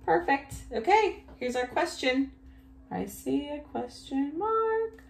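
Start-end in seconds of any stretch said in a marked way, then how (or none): 2.9-5.0 high sing-song. Pretend. Teacher spotting punctuation with children, high sing-song. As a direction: sing it out high with a rising end. Playful repeat in two voices.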